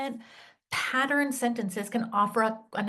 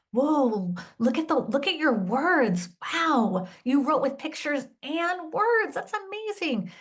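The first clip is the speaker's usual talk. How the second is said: delight over a kindergartner's pictures and words; excited praise; 6 semitones higher than usual. Shown as a demonstration. Teacher praising a young writer's first words, excited. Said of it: "I might say".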